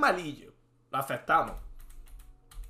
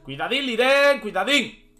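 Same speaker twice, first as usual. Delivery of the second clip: loud playful warning, pitched up; mock warning; 4 semitones higher, 6 dB louder, slower than usual. Streamer warning Liren over the board, mock warning. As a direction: call out a teasing heads-up.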